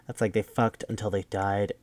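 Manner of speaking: ordinary talk, nothing marked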